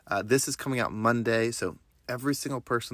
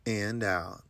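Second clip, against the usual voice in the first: guided meditation; 2 semitones lower than usual. Acted. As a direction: guide them through breath and affirmations gently. Leads a breathing meditation.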